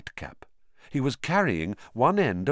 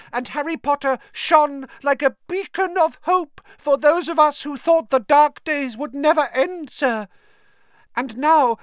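high, loud, pleading elf voice; a humble house elf, high and eager; high squeaky house elf; 7 dB louder than usual. Acted. Dobby telling Harry of the dark days, in a high elf voice.

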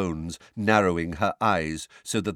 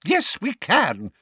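slow, high, loud indignant retort; 13 semitones higher, 5 dB louder, slower than usual. A narrator's reading. Witness answers the doubting minister, high and indignant. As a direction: insist indignantly, drawing the words out. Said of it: "said Missus Figg indignantly".

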